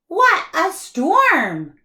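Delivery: a little bird twittering about the weather; twittering wren. Acted. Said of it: "twitters wren".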